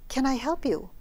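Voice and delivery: a hint of a singsong Bengali accent, offering help; lilting Bengali accent. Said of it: "in his lilting Indian accent, Bengali".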